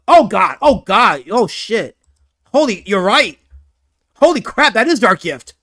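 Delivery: loud exclamations of dismay; shocked disbelief. Real, not acted. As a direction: blurt it out, stunned by what you see. Reacting to seeing the picture.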